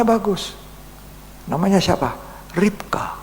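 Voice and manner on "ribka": the name breathed in a whisper. Real, not acted. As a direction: let the name out as a hushed secret. Revealing the name softly. (bisikan nama)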